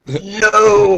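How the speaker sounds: a sudden, loud, high "No!"; loud shocked outburst. Real, not acted. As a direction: blurt out disbelief. Shocked reaction to story.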